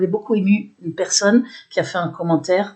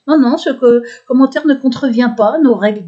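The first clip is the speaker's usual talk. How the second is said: higher, louder quoted brush-off; mock dismissive refusal; 5 semitones higher, 5 dB louder than usual. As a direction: imitate a curt official rejection. Retells Amazon's refusal of complaint.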